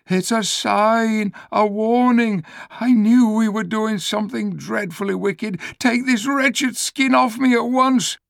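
terrified donkey crying out; frightened donkey. Acted. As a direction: beg in alarm to take it off. Terrified donkey after thunderclap.

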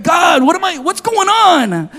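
high pitched cry to God; desperate prayer. Retold or imitated. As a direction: plead upward in panic.

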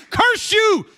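loud, high curse at the enemy; fierce shouted rebuke. Acted. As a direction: bark it out at the devil.

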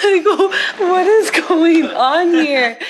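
loud, high, drawn-out laughing question; laughing disbelief. Real, not acted. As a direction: crack up while asking, stretching the words. Laughing after hearing the played clip.

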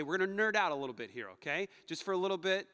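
ordinary delivery

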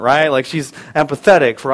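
normal talking voice

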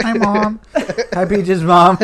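giggly hello to the camera; laughing, bashful greeting to mom; awkward joy. Real, not acted. Waving to moms on camera.

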